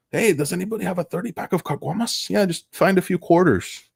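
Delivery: high, excited buddy call; mock party guy asking round. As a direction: shout it like a cheap-beer regular. Voicing beer buddies.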